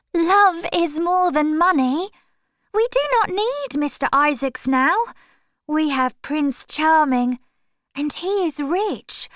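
put-on a young actress defending her sweetheart; dreamy young Sybil. A narrator's reading. Young woman in love.

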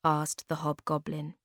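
ordinary delivery

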